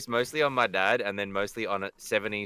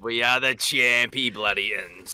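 slow, loud mock-heroic chant; mock anthem; 6 dB louder, slower than usual. Friend answers the champion call, mock-heroic chant. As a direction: belt it like a silly team anthem.

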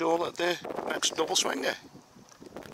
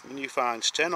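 ordinary delivery

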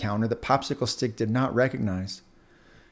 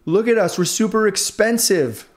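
slow, higher, showing-off drawl; boastful; 5 semitones higher, 4 dB louder, slower than usual. Acted. Earbuds brag to Popsicle Stick, smug and boastful. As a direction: lord it over the wooden stick. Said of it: "boasted the earbuds".

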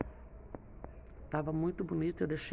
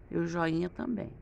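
ordinary delivery

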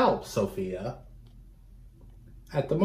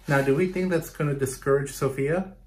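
normal talking voice

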